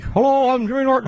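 mock Jimmy greeting; Jimmy impression. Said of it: "it's like".

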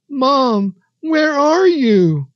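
put-on very high, loud child's cry for mother; tearful calling out. A narrator's reading. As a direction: cry out in panic, stretching the words. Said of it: "he called out shakily".

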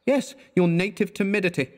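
high dry mockery; mock agreement; sarcastic. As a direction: needle him. Sarcastic jab.

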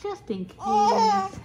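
high, slow little ghost voice; high, drawn-out little ghost. A narrator's reading. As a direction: stretch it out up high like a small ghost. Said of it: "said the little ghost".

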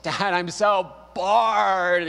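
put-on high, slow, drawn-out childish whine; whiny bored kid. Young son whining to his dad about boredom. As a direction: stretch it out like a bored little kid. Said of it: "the whole body movement and everything".

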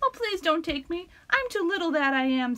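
high, pleading little goat; a timid plea in a tiny voice; shy. Acted.

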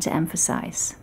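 "emphasize" whispered, breath only; whispered emphasis. Shown as a demonstration. Voice coach contrasting a whispered word. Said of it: "accents and intonation".

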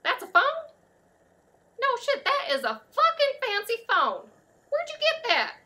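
high, loud, floored by what you see; loud amazement. Acted. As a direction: exclaim in high-pitched disbelief. Amazed at customer's fancy phone.

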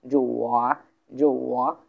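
"জো ওয়া" dipping down, then rising; teacher's dip-and-rise tone on "জো ওয়া"; third tone. Shown as a demonstration. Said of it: "এই টোনটা হচ্ছে একটা third tone".

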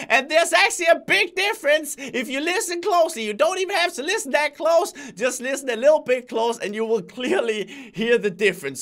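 squeaky hyped shout; old Crainer voice. Shown as a demonstration. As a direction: blast it like the old persona. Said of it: "the old voice sounded like this".

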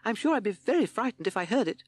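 high-pitched relieved toad voice; timid toad. A narrator's reading. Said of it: "said Toad".

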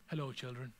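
low, soft, placid parent voice; gentle, hushed, pretend-patient hello; mock-serene greeting. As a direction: greet them with put-on calm. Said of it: "I don't go".

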